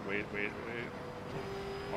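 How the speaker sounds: slow stretched wait, wait, wait; drawn-out wait. Real, not acted. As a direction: draw each wait out slowly. Driver holding off the throttle.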